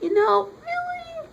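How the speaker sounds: slow, very high-pitched "really"; drawn-out incredulous squeak; mock disbelief. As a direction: stretch it out and send the pitch way up. Acts out the reader's disbelief.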